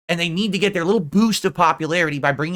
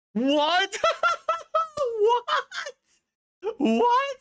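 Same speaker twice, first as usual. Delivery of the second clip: high squeal through laughter; laughing disbelief; 4 dB quieter, much slower than usual. Real, not acted. Reacting to a silly request, laughing in disbelief. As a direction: crack up while asking, pitch shooting up.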